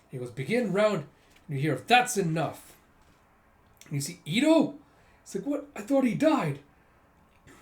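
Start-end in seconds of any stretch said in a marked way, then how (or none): 0.3-1.1 higher, louder command; the game master proclaiming the round; loud announcement. Acted. Villain announces the next round. 1.9-2.8 loud, firm call to stop; an unexpected voice halting the game; commanding interruption. Acted. Someone interrupts from afar. 5.5-6.6 much higher, louder shocked cry; stunned to see the dead man alive; disbelief. Acted.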